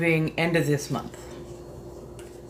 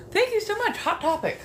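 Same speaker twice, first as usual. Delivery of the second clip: much higher, bright cheerful thank you; chirpy high thanks. Pretend.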